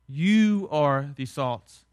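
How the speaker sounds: slow line with a long, weighted "you"; stress on YOU. Shown as a demonstration. Jesus addressing the people around him, "you" stressed slowly. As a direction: draw out and punch "you". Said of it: "you" is emphatic".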